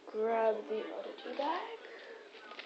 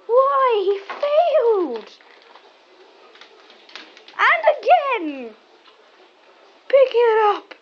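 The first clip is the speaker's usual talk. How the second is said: much higher, louder and slow; mock outrage; 11 semitones higher, 15 dB louder, much slower than usual. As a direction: wail theatrically at the dog. Mock outrage at dog's fail.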